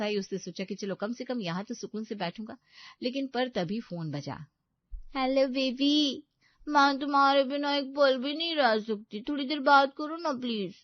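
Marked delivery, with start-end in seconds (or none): 5.2-10.7 raised, syrupy phone pleading; wheedling, lovesick girlfriend; clingy girlfriend. Acted. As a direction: coo needily down the phone. Voices Payal on the phone.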